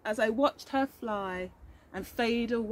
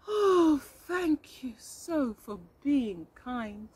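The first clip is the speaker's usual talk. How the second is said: grateful bee; 2 semitones higher, much slower than usual. Acted. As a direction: sigh out a slow thank you. The bee thanks the narrator.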